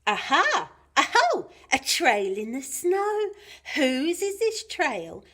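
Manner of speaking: high, loud, excited discovery; Gruffalo's child. Acted. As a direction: pipe up like a child on a trail. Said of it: "went the Gruffalo's child".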